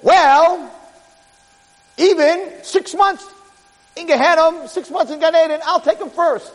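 high, sly offer; bargaining Satan. Acted. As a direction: haggle for the soul first. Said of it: "the Satan says".

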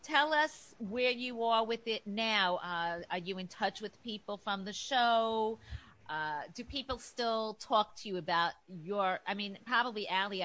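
ordinary talk, nothing marked

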